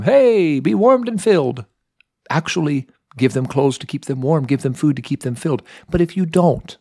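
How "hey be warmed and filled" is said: high, airy, dismissive well-wishing; mock breezy brush-off. Preacher mocking someone who offers only words. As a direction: toss off an empty blessing, higher. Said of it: "James says, don't just tell them".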